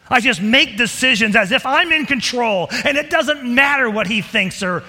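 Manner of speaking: loud, rising sermon delivery; preaching at full force. Acted. Preacher pleading with the congregation, loud and driving. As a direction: drive it home to the congregation.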